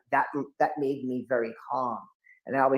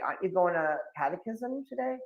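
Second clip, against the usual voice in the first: dad asking; 5 semitones higher, 5 dB quieter than usual. Retold or imitated. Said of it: "my dad's like".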